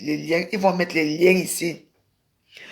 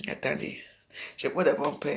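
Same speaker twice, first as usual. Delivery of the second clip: whispers to herself while checking something; a quick under-the-breath whisper; whispered aside; 5 semitones lower, 10 dB quieter than usual. Real, not acted. Streamer whispering to herself mid-live, unvoiced.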